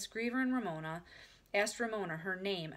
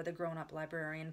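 usual speaking voice

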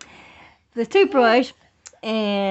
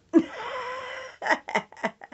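high squealing laugh of surprise; mom's sudden squealing laugh; startled laugh. Real, not acted. Mom startled by baby.

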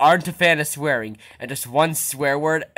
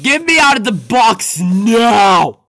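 slow angry demand; 5 semitones higher, 6 dB louder, slower than usual. Acted. Trapped creature shouts demand.

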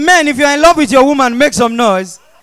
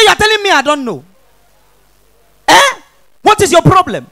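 raised, higher confrontational voice; indignant challenge; 6 semitones higher, 5 dB louder than usual. Acted. Offended person confronting the one who stepped on them, indignant. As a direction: pick a loud mock quarrel. Acted quarrel after stepping on someone.

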